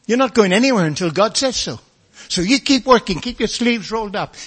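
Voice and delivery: loud, high preaching exhortation; preaching at full force. Acted. Preacher urging the congregation to keep going, loud and high. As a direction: lift the room, urging them on.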